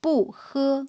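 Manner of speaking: "bu" falling sharply before "he"; fourth-tone bu. Shown as a demonstration. Teacher showing the tone of bu, "bu" falling before "he". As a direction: start "bu" high and drop it, then "he". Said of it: "Bu is a fourth tone syllable".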